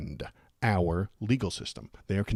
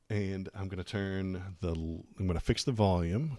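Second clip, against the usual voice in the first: ordinary delivery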